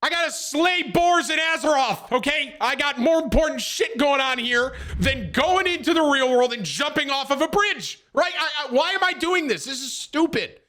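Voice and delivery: high-pitched, worked-up rant; exasperated protest. Retold or imitated. Gamer arguing against bridge jumping, exasperated protest. Acted protest at being asked along.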